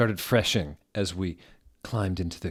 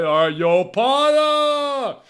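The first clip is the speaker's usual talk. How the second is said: much higher, louder shouted call; a hammed-up yell at Potter; 8 dB louder, slower than usual. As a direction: holler his name across the street. Mock thug calls out Potter.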